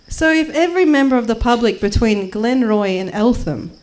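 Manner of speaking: ordinary delivery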